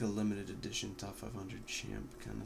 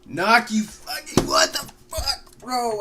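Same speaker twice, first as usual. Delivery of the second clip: raging, much higher and louder; furious shout; 12 semitones higher, 14 dB louder, slower than usual. Real, not acted. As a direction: scream in gamer rage. Killed in game, raging.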